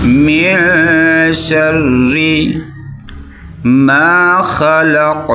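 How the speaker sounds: slow melodic tajweed chant; quranic recitation. Shown as a demonstration. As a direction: recite slowly, drawing out each vowel. (धीमी सुरीली क़िरात)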